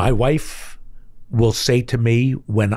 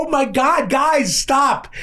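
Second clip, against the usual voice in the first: high-pitched retold cry to stop; exasperated outburst; 12 semitones higher, slower than usual. Dad under a barrage from his kids, exasperated outburst. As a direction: cut them off, voice pitched way up.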